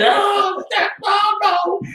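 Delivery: high, desperate cries of no; terrified pleading. Acted. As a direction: beg in terror from the corner. Cornered, screaming before dying.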